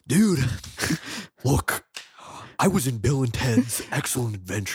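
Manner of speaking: lightly voiced laid-back surfer dude; Keanu Reeves impression. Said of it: "he talks like this".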